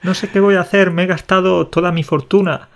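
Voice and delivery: high, pleading mock lament over the car; hammed-up despairing driver. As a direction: whine about the car like it ruined you. Continues [name]'s car rant.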